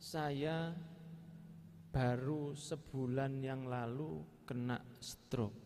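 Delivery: very slow, halting delivery; bicara pelan. Retold or imitated. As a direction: imitate a stroke patient's slow speech. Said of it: "pelan ngomongnya". (menirukan penderita stroke yang bicaranya pelan)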